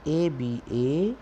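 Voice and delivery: ordinary delivery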